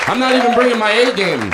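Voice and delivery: high, worked-up yell. Real, not acted. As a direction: yell it out in comic boasting. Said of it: "Why am I yelling?".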